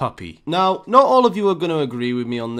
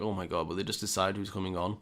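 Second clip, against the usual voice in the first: much lower, much softer, fast grumble under the breath; muttered passenger annoyance; 8 semitones lower, 12 dB quieter, faster than usual. Retold or imitated. Passengers watching the arguing pair, quick annoyed mutter. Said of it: "looking at them like".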